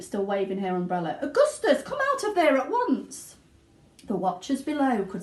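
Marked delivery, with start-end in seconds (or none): 1.2-3.3 very high, loud scolding yell; yelling mother. A narrator's reading. Mother yelling at her stuck son, loud. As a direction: order him out at full cry. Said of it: "yelled Missus Gloop".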